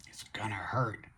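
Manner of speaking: whispered warning, imitating his daughter; ominous whisper. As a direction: breathe it like a spooky warning. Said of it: "she said".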